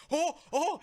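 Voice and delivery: high, mock yelps of pain; mimicked pain yelps. Storyteller imitating a friend pulling a hamstring, mock pain yelps. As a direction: imitate someone yelping as a muscle pulls.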